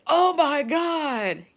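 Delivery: high, loud, slow shocked gasp of words; imitating a stunned viewer. Imitating a viewer shocked by the grocery bill, loud. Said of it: "in case you're like".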